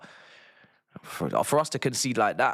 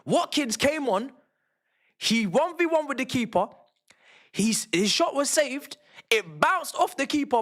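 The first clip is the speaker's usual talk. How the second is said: exasperated rant; 12 semitones higher than usual. Real, not acted. Ranting about lucky goal.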